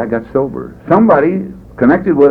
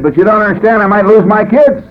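high, raised protest; retold pleading; 10 semitones higher, 4 dB louder than usual. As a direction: plead your case, pitched up and louder. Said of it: "but I said".

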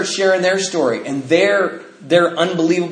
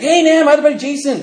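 eager caller; 11 semitones higher, 5 dB louder than usual. Retold or imitated. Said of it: "He's like".

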